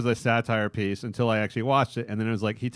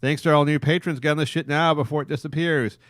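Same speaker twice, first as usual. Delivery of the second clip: announcer read; 3 semitones higher, 4 dB louder than usual. Acted. Podcast plug read.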